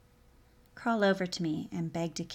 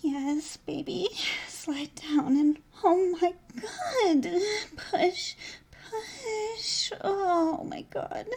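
much higher, drawn-out cries; moaning pleasure; 11 semitones higher, 5 dB louder, much slower than usual. Acted. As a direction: cry out in pleasure.

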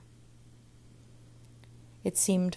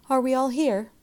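higher, louder roll-call question; teacher calling class; 7 semitones higher, 7 dB louder than usual. A narrator's reading. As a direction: call out to check on the whole class.

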